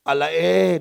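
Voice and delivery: loud mock Batangueño drawl; Batangueño provincial accent, punched out; Batangas accent. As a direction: put on a playful Batangas accent. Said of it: "O kaya pag Batanggenyo".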